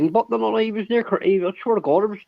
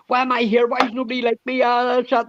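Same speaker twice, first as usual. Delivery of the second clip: higher-pitched imitation of someone flustered; mock flustered; 6 semitones higher than usual. Mocking how he gets worked up when interrupted, mock flustered. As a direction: mimic a man getting worked up, voice pitched up. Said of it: "he gets all flustered".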